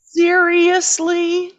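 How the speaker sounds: high, loud, drawn-out disbelief; mock incredulity. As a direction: stretch it out in exaggerated exasperation. Mocking an exasperated character's reaction.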